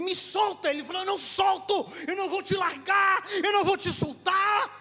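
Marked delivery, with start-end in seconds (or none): 0.0-0.7 high, urgent order to let go; shouted demand. Acted. Angel fighting Jacob, shouted demand. 1.0-4.7 high, loud, stubborn cries; defiant shouting. Acted. Jacob gripping the angel, defiant high shouting.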